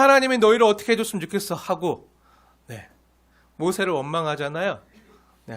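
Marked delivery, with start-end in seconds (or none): 0.0-1.5 mock angry curse. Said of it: "하면서 아주 그냥".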